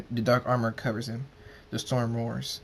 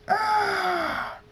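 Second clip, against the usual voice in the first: very high, piercing scream; 3 dB louder than usual. A narrator's reading. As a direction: let out a high scream on hitting the ground. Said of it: "and screams".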